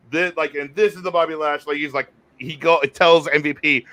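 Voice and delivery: normal talking voice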